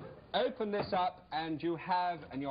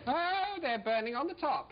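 surprised; 10 semitones higher than usual. Real, not acted. Checks grill, finds rolls burning.

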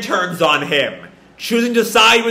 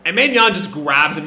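ordinary talk

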